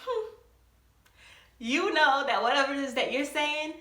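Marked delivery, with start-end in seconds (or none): 0.0-0.3 high doubting Caribbean hum; Caribbean parent not buying it, high hum; high skeptical hmm. Retold or imitated. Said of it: "that little noise of".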